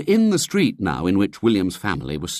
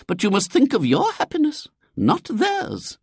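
very high, earnest lady's voice; a well-meaning lady pressing her advice. A narrator's reading. Lady counsels unhappy William, earnest and high.

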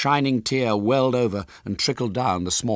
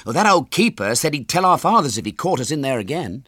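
higher, louder schoolboy; Ginger; 8 semitones higher, 6 dB louder, faster than usual. A narrator's reading. Boy warns friends about the keeper, high and loud. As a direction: object in a boy's voice. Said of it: "said Ginger".

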